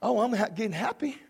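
usual speaking voice

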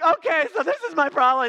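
high, loud mocking voice of a needy newcomer; exaggerated high whine; mock whiny complainer. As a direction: ham up someone dumping their problems. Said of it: "do not go to the group and be like".

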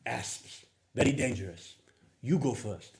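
hushed mock Middle Eastern accent, deadpan warning; a hint of Middle Eastern accent; imitating Middle Eastern accent. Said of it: "his Middle Eastern friend looks over and goes".

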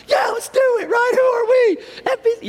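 very high, squeezed mock yell; over-eager fan. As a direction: play the inner fan bursting out. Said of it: "the fan inside of you's coming out".

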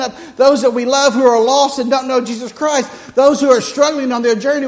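loud, high, driving sermon voice; full-force preaching. Acted. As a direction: preach it hard to the congregation.

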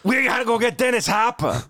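urgent mock Brooklyn impression, pitched high; bad impersonation. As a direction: do a badly faked Brooklyn movie hero. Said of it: "I can't do impersonations".

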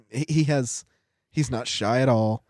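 ordinary talk, nothing marked

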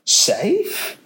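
high breathy disbelieving echo; incredulous Beaver. A narrator's reading. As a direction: toss the word back in disbelief. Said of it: "said Mister Beaver".

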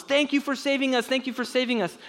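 high, cheering thanks; grateful crowd. Retold or imitated. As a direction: sing it out like a happy crowd.

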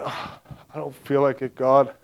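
soft, grumbling mock reluctance; retold reluctance. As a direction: beg off quietly, as if put upon. Retold reluctant reply to God.

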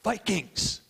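high, ringing call to the team; pumped-up huddle shout; retold team cheer yell. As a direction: call out the captain's rallying cry. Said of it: "he yelled at the team".

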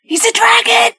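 squeaky, high-pitched insistence; high-pitched exclamation. Acted. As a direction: repeat the secret in a sudden high voice. Repeats whispered secret after "What?.

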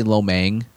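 "mein" ending in an ng sound, like "mang"; old mispronunciation. Shown as a demonstration. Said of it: "I used to say".

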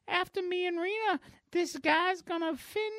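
high, slowed coquettish voice; hammed-up mock seduction; flirting superheroine. Voicing Ivory flirting with the shark.

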